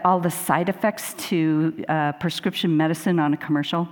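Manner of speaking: normal talking voice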